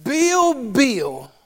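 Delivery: Southern US "Bill Beale", both names rhyming; Southern accent. Shown as a demonstration. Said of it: "Any Southerners know?".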